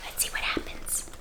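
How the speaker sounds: hushed storyteller whisper. Acted. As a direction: lean in and whisper the suspense. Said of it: "storyteller hushes before next page".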